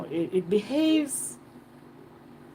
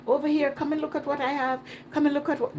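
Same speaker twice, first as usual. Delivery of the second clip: higher-pitched mock hawker's pitch; quick, imitated come-on to passing tourists; mock vendor hawking; 8 semitones higher, faster than usual. Imitating vendors soliciting visitors at the gate, mock hawking. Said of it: "they can't go".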